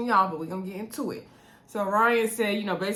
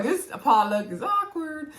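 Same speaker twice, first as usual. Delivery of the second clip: mock awkward; 7 semitones higher than usual. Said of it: "I was like".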